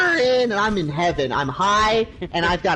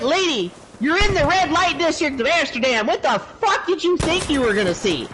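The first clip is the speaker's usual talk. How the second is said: higher-pitched, incredulous telling-off; mock exasperation, pitched up; exasperated retort; 7 semitones higher than usual. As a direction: set a clueless tourist straight, exasperated. Retold retort to angry tourist.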